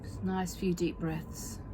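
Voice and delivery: soothing massage murmur. Acted. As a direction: ease the listener into calm with long pauses. Leads a guided relaxation exercise.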